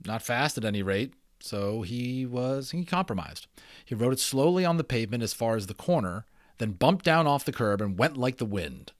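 lively storybook reading voice; story narration. A narrator's reading. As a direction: read it like a bedtime chapter book. Reads a children's novel aloud.

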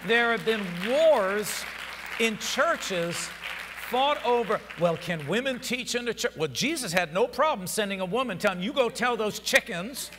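high, loud, climbing pulpit cry; preaching at full force. Acted. Preacher voicing Jesus sending Mary, loud and high. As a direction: whip the crowd up with it.

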